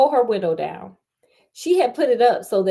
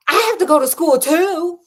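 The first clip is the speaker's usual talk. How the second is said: higher and louder, snappish retort; snapped; 8 semitones higher, 6 dB louder than usual. Shown as a demonstration. Host redoing the snapped line, higher and louder. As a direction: bite the line back at him. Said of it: "let me do it better".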